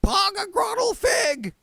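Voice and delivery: very high shrieked gibberish; high piercing garbled shout. A narrator's reading. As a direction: scream the nonsense out.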